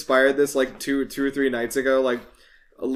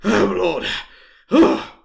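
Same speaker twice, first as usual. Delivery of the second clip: panting town guard, louder and higher gasps; acted out of breath; 5 semitones higher, 6 dB louder than usual. Guard arriving late after running over, acted panting. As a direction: play a winded guard puffing between short phrases. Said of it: "he's panting, and he's like".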